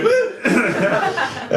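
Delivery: high mock "woo"; lone audience whoop, mimicked; imitated cheer.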